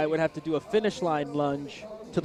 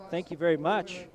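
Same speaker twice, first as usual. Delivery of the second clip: ordinary talk, nothing marked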